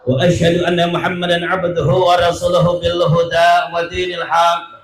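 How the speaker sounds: steady chanted Arabic opening, long held notes; Arabic sermon recitation. Acted. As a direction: intone the opening praise like a preacher.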